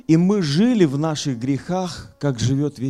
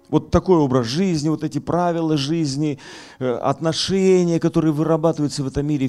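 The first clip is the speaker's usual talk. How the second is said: raised, forceful pulpit voice; fiery preaching; 9 semitones higher, 6 dB louder than usual. Acted. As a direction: preach it hard to the hall.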